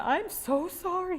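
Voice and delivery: high, slow, tearful apology; near tears. Retold or imitated. Wife apologizing tearfully to her husband, near tears. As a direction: say sorry on the verge of crying. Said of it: "I was almost in tears".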